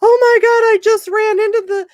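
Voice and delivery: woman on the phone, amazed the message landed, high excited gush; excited caller. Retold or imitated. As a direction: squeal it out like a stunned, thrilled mom. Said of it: "the woman calls me four years later and says".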